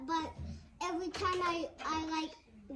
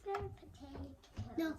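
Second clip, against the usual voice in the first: normal talking voice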